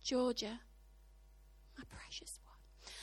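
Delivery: hushed, slow and tender whisper; low, loving whisper as the Spirit's gentle prompting; gentle Holy Spirit. Acted. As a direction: whisper it softly, with long pauses. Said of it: "He probably says something like".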